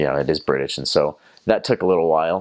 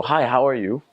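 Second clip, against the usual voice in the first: higher-pitched cheery hello; acted polite greeting; friendly street greeting; 8 semitones higher than usual. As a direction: greet a passer-by brightly. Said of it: "you go".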